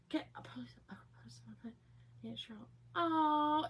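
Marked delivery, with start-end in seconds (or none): 0.1-2.6 hushed aside off camera; whisper. Real, not acted. Aside to someone in the room, whispered. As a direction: whisper it so viewers barely catch it.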